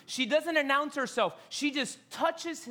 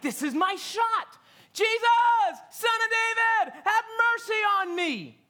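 high, loud cry to Jesus; desperate shout; 11 semitones higher, 6 dB louder than usual. Acted. Blind man shouting to Jesus over the crowd, desperate. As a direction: yell across the crowd, begging.

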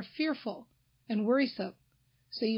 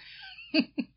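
laugh. Real, not acted. Laughs at her own joke.

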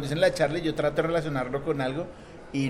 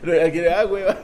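loud, laughing words; helpless laughter; 4 semitones higher, 7 dB louder than usual. Real, not acted. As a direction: barely get it out for laughing. Cracking up over own slip.

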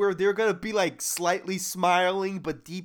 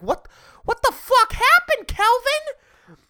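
high, loud shocked wife voice; wife yelling; 4 dB louder than usual. Retold or imitated. Wife bursting in, yelling at her husband. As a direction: shriek it up high at him. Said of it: "He's like".

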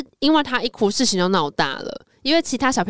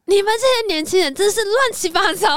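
much higher put-on old principal scolding; fussy principal; 10 semitones higher than usual. Principal scolding the young teachers, higher fussy voice. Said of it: "校长说".

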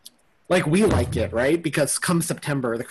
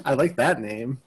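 ordinary talk

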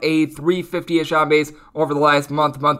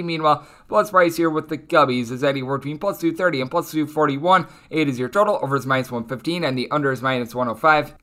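ordinary talk

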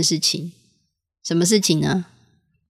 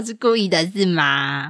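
ordinary talk, nothing marked

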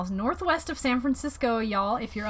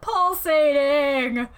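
Pulsating stretched out slow and loud; drawn-out Pulsating; 4 semitones higher, 6 dB louder, much slower than usual. Pretend. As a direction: linger slowly on each syllable of Pulsating. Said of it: "savoring the gross word".

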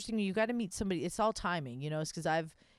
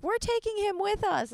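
high-pitched imitation of the couple; imitated announcement; 13 semitones higher than usual. Couple telling a wife they're taking her husband, imitated announcement. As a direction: pipe it up high, drawn across the line.

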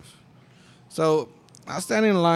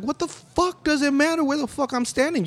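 exasperated retort; 13 semitones higher than usual. Retold or imitated. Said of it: "And it was just like".

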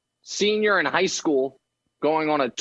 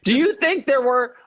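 voice going up; 6 semitones higher than usual. Real, not acted. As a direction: voice jumping higher in excitement. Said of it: "There's that voice going up again".

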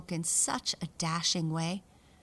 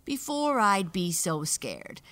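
taunting; 2 semitones higher, 6 dB louder than usual. Acted. Boastful mouse taunts siblings.

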